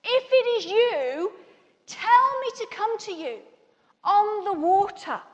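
high, ringing plea, loud on the first word; crying out. Retold or imitated. Peter calling to Jesus from the boat, crying out. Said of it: "cries out".

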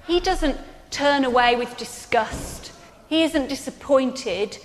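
ordinary delivery

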